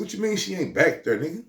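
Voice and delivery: high, incredulous question; can't believe what he's hearing; reacting to news the woman is gone; disbelief. Disbelief at friend.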